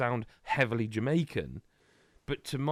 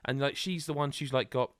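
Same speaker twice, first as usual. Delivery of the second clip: normal talking voice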